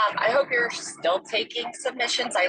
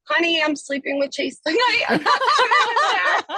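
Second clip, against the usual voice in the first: laughing retort; 7 semitones higher, 4 dB louder than usual. Retold or imitated. Retelling her scared reply to husband.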